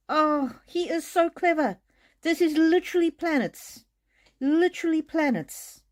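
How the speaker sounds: high, awed excitement; amazed. Real, not acted. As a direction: marvel at the cards out loud. Amazed reading of cards.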